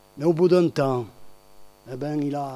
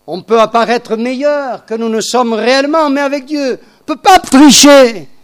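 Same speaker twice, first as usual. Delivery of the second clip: building from raised to full shouting; rising preacher's shout; 4 semitones higher, 11 dB louder than usual. Real, not acted. Preacher to congregation, swelling into a shout. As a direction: hammer it home louder and louder.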